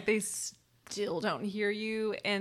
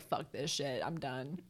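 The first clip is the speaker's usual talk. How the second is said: low, soft and fed up; weary old person; 6 semitones lower, 8 dB quieter than usual. Retold or imitated. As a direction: sigh it out like a tired old soul. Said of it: "that version of my soul is like".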